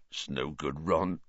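low, hushed resignation; sad. A narrator's reading. Hagrid telling Ron there is no hope, sad. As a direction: give up softly and sadly. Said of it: "said Hagrid sadly".

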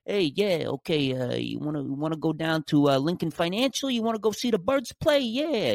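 mock movie-guy Philly accent, pitched high. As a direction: caricature a bad film version of a Philly accent. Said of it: "whenever they do the Philly accent in movies".

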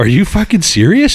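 high incredulous shout; shocked disbelief. Retold or imitated. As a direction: react in total shock. Shocked at teacher.

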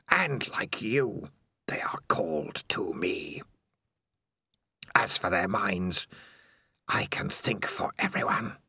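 slow, ghostly whisper from the dark; a creeping, hissing whisper of a lurking monster; eerie spider voice. Acted. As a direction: breathe it like a spider in his head.

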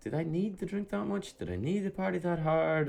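high, soft mock-regretful questioning; next-day regret. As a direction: question yourself in a small, high voice. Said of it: "you're kinda like".

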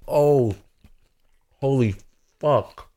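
startled burst at the spice; shocked at the heat. Real, not acted. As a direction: hit by the heat, blurting out. Reacting to the hot wing.

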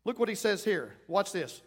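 ordinary talk, nothing marked

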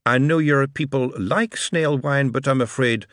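put-on lightly French-accented host; a polite French aristocrat greeting; French Marquis. Marquis replies.

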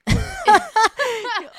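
sudden high burst of loud laughter. Real, not acted.

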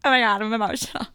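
mock overwrought teen; mock emotional. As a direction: blurt it out like a melodrama. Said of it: "I was like".

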